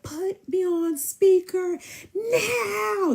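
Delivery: very high, drawn-out holler; hammed-up yell stretching the last word; mock yelling. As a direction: imitate him screaming down the phone, slow. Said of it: "This is what he yelling".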